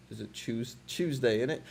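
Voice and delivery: mock British accent, saying Tuesday as "CHOOZ-day"; British English impression for fun; imitating British accent. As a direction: put on a playful British "innit?". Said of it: "That's how they say Tuesday".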